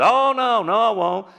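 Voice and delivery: high, loud startled denial; a hammed-up Peter pleading no; Peter protesting. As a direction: protest it like a man caught off guard. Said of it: "And Peter said".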